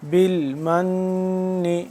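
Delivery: بالمن with the نّ held long and nasal; ghunna on the doubled noon. Shown as a demonstration. Teacher demonstrates ghunna to students: بالمن slow with a long nasal hum. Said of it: "غنہ کریں گے نون مشدد پہ".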